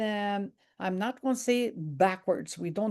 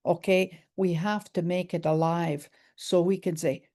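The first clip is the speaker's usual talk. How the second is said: usual speaking voice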